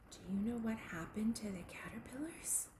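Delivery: ordinary delivery, nothing marked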